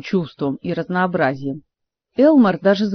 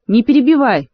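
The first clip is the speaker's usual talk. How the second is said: loud, higher snap at him; sharp shout; 5 semitones higher, 5 dB louder than usual. A narrator's reading. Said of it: "прикрикнула королева". (резкий окрик королевы)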